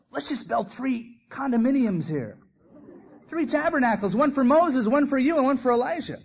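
hammed-up eager Peter; eager blurting. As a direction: blurt it out like an overexcited kid.

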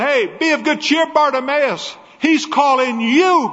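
high, eager call to the beggar; excited calling. Acted. Crowd telling blind Bartimaeus that Jesus is calling, eager and high. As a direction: urge him on joyfully.

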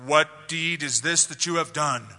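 mild mock rebuke from the throne; lightly voiced harsh Joseph. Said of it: "I think Joseph said it in a harsh voice".

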